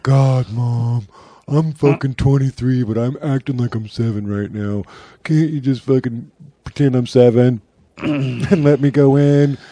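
bratty, whining son; whiny adult kid. Retold or imitated. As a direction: whine like a spoiled kid. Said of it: "by going".

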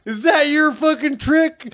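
high, drawn-out indignant demand; mock angry challenge. Storyteller as the pissed man confronting teens, high mock anger. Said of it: "he was pissed".

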